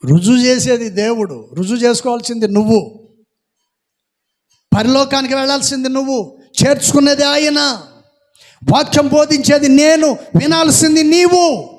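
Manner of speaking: loud, rising pulpit exhortation, hammering each phrase; preaching at full force. Acted. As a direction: drive the point home to the congregation.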